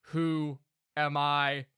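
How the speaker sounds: golem voice. Acted. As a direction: ask it blankly like a new golem. Golem repeats question.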